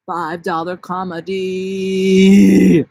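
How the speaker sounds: drawn-out promo call; announcer voice. Acted. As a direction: boom it like a promo. Announcing a bit.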